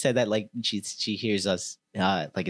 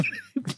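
high, short embarrassed laugh; a quick awkward laugh at yourself; 6 dB quieter than usual. Shown as a demonstration. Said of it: "I laugh awkwardly".

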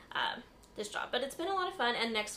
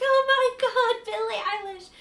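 high, loud, drawn-out fan shriek; excited scream; 13 semitones higher, 10 dB louder than usual. Retold or imitated. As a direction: squeal it like a starstruck fan. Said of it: "screamed the whole night".

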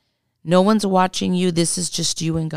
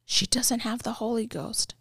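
higher, hushed imitation of a companion; whispered aside; 5 semitones higher, 8 dB quieter than usual. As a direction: whisper it as a discreet aside about someone. Companion answers aside for seeker.

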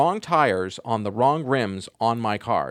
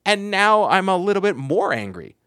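worked up, higher-pitched venting; real irritation rising higher and louder; angry; 6 semitones higher, 4 dB louder than usual. Real, not acted. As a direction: own up to being angry, voice climbing. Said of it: "I'm angry".

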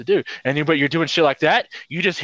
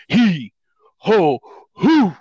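effort grunts; 5 semitones higher, 6 dB louder, much slower than usual. Retold or imitated. Heaving kid into truck.

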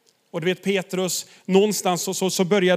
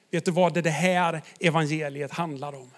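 normal talking voice

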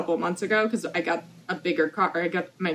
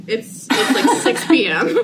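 louder, slowed down by laughter; laughing protest; 4 semitones higher, 6 dB louder, slower than usual. Retold or imitated. Retelling her answer to the nosy neighbour, laughing protest. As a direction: protest the obvious while cracking up.

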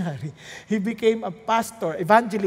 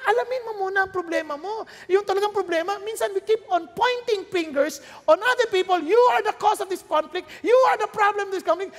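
high, raised sermon voice; forceful preaching; 9 semitones higher, 4 dB louder than usual. Real, not acted. Pastor urging the congregation to keep praying, forceful preaching.

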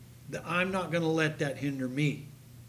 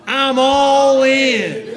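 very slow, much louder, laughing; loud rally call; 8 semitones higher, 14 dB louder, much slower than usual. Shown as a demonstration. Pastor leading congregation in a loud chant. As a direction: belt it out to the crowd. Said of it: "No, louder".

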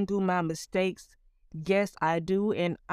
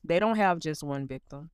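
ordinary talk, nothing marked

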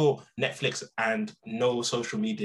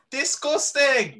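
slow, drawn-out, high pitched disgust; mock disgust; 13 semitones higher, 5 dB louder, much slower than usual. Teasing his co-host, slow high mock disgust. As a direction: stretch it out like you're appalled for the joke.

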